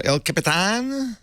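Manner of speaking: El Capitan drawn out slowly, pitched high; hammed-up name. Host asking who downloaded El Capitan, hammed-up and drawn out. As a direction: stretch the name with a rising flourish.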